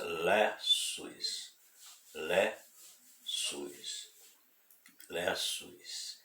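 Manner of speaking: Lessus drawn out as LEH... soos; pronunciation demo. Shown as a demonstration. Preacher asks if the name is familiar, Lessus in slow, separated syllables. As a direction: sound out Lessus slowly, syllable by syllable. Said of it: "Vê se é familiar pra você essa pronúncia".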